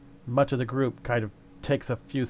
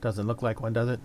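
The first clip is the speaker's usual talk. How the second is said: normal talking voice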